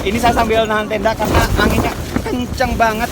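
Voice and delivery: shouting over wind. Real, not acted. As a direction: call out over howling wind. Holding tent in strong wind.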